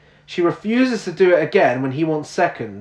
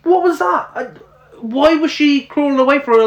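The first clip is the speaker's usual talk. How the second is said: incredulous; 9 semitones higher, faster than usual. Real, not acted. Baffled by film ending.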